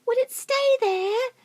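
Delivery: very high, slow worried question; anxious chorus. Acted. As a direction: fret as little animals. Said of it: "they cried".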